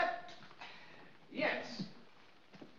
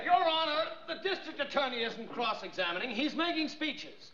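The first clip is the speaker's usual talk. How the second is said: raised, heated objection; indignant protest; 6 semitones higher than usual. Acted. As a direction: protest it, pitched up.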